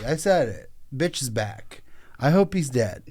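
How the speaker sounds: ordinary talk, nothing marked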